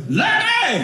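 high yell of a rough mama; raspy mama yelling. Retold or imitated. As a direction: holler the name across the yard. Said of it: "in a very raspy voice".

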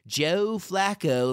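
hammed-up Baltimore accent, drawn out and high. Shown as a demonstration. Host reading a player's name to co-hosts, playful bad Baltimore accent. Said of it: "the Baltimore accent, just saying Joe Flacco's name that way".

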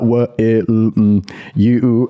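halting, low mumbled sounding-out; slow, puzzled reading. Retold or imitated. Retelling reading the proposal on the Crocs, slow puzzled sounding-out. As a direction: struggle through it one word at a time. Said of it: "it took me a while to read".

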